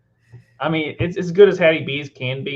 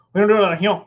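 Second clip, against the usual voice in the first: mumbled, slurred Cajun Southern, higher and louder; Memphis Cajun Southern accent; 7 semitones higher, 7 dB louder, faster than usual. Retold or imitated. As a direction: slur it all together in thick Memphis Southern. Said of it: "The guy shook his head, goes".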